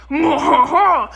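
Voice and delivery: lightly laughing French-style moo; French cow moo. Pretend. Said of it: "French cows be like".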